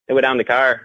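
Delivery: Irish-like Nova Scotian sound; Nova Scotia accent. Shown as a demonstration. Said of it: "they got that sound".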